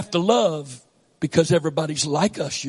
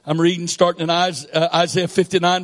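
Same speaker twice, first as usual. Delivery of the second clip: normal talking voice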